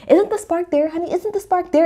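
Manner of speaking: high, sugary mock pleading; mock sweet plea. Wife coaxing her husband, high mock-sweet pleading. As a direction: ham up a wife begging her husband for a spark.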